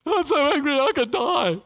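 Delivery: high, loud whiny tantrum; tantrum like a three-year-old. Retold or imitated. Preacher mimicking Jonah as a sulking three-year-old. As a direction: pout it out like a sulking toddler. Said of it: "three-year-old".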